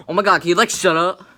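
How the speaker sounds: exasperated yell. Retold or imitated. Friends on the bus snapping at the new kid, loud exasperated. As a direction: yell at him to stop talking.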